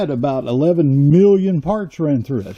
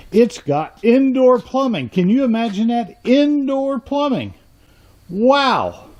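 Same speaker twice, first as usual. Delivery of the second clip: high, drawn-out salesman's wonder; hammed-up awe, pitched way up; mock amazement; 7 semitones higher than usual. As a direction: marvel at it as if it were a luxury. Mock amazement at run-down shop feature.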